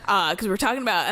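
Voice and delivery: ordinary talk, nothing marked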